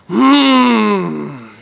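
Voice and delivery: hammed-up long loud yummy moan; loud mock moan of delight. Streamer tasting pizza for viewers, loud mock moan. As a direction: overdo the pleasure of the first bite. Exaggerated eating moans.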